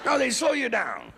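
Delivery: normal talking voice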